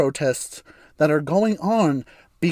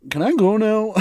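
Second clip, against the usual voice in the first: higher-pitched little question; comic plea to be let go; mock sheepish plea; 7 semitones higher, 4 dB louder than usual. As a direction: ask it high, wanting out. Awkward patient asking to leave.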